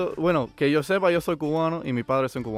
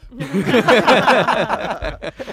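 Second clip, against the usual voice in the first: loud burst of real laughter; 8 semitones higher, 10 dB louder than usual. Real, not acted. Guest told he looks Scottish, laughing out loud. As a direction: laugh out loud, caught off guard.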